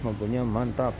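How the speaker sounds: usual speaking voice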